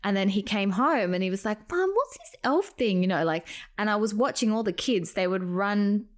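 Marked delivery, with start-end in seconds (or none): 1.7-3.0 a lightly voiced little boy, pitched higher; a hint of a kid's voice; young son. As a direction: ask it like a curious small child. Voicing her young son.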